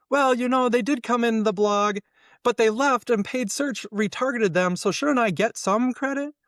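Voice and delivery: high, pleading mock whine; hammed-up plea for credit, pitched high; whiny credit-seeker. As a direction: beg for credit like a needy marketer.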